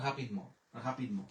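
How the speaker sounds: হাপিদ ম short and run together; professional pronunciation. Shown as a demonstration. Teacher shows the pro's version, compact and joined. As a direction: drop the vowel between দ and ম in হাপিদ ম. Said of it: "pro হয়ে গেলে হচ্ছে মানে professional".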